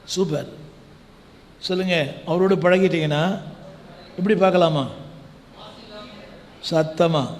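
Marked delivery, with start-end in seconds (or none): none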